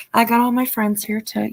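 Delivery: usual speaking voice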